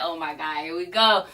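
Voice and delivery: tired low mutter of here we go again; a drained, resigned groan under the breath; weary sigh. Retold or imitated. Wife groaning at husband starting a fight again.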